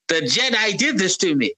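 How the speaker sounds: a hint of Palpatine crying foul after Mace attacks; bad Palpatine impression. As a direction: play the wronged victim in a thin whine. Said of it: "You have the worst Emperor Palpatine accent ever".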